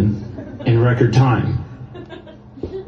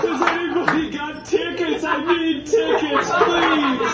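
very high, thin tout call; pushy ticket tout; 5 dB quieter than usual. Acted. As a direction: hawk tickets in a strained falsetto. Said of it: "an impression of a guy that lost his son".

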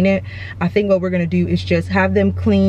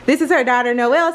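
normal talking voice

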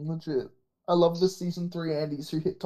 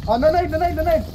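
grenade warning yell; 10 semitones higher, 6 dB louder than usual. Real, not acted. Grenade incoming, warning teammates.

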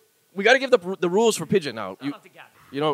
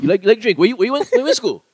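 higher, louder Hawaiian pidgin come-on; quick-fire pidgin pickup questions; pidgin questioning up-talk; 7 semitones higher, 7 dB louder than usual. Acted. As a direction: pitch it up like pidgin flirting. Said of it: "everything's asking a question".

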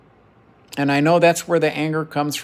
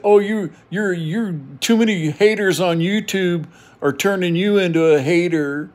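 higher-pitched mock indignation; imitating angry friend; 6 semitones higher than usual. Retelling an angry friend blaming YouTube haters, mock indignant. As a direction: mimic an angry friend's accusation. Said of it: "he got all angry with me".

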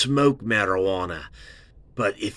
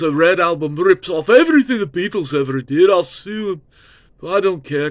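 [name] impression; 7 semitones higher than usual. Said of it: "and Paul's like".

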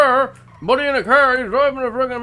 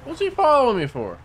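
put-on thick rural accent; 9 semitones lower than usual. Local insists this is how he always talks, thick rural accent. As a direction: lean hard on the rural accent and the boy.

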